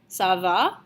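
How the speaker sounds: "Ça va?" with the voice going up at the end; question rise on "Ça va?". Shown as a demonstration. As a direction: lift "va" sharply into a question. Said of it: "you should be pronouncing it something along the lines of".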